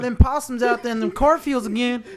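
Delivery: put-on excited country boy voice with a laugh. Country kid role.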